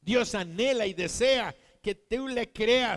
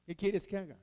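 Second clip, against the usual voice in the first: dry reply from God; 2 semitones lower, 8 dB quieter, faster than usual. Acted. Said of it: "Dios dice".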